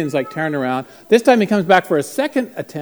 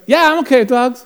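higher, louder answer across a room; calling back; 10 semitones higher, 8 dB louder than usual. Retold or imitated. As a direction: holler back agreement. Calls back to the man.

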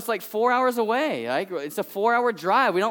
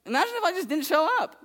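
mock plaintive; 8 semitones higher, faster than usual. Pastor teasing congregation.

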